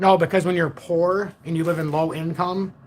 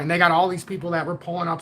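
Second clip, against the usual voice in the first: normal talking voice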